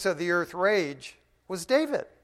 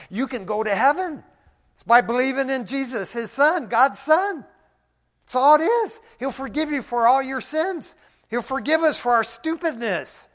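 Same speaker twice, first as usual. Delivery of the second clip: rising, louder and higher as the gospel pours out; bold street preaching; 8 semitones higher, 7 dB louder than usual. Retold or imitated. Preacher calling out to the men blocking him, with rising fervor. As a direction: proclaim it with growing fire.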